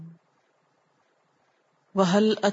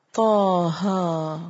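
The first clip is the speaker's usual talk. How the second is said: Taha drawn out very slowly in tajweed recitation; much slower than usual. Shown as a demonstration. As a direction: stretch Taha as in Quran reading. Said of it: "لفظی ترجمہ". (طٰہٰ کو آہستہ کھینچ کر تلاوت)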